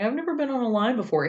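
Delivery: high, quick imitation of a whiner; mock whining. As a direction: whine an excuse in a put-on voice. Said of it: "making excuses and whining".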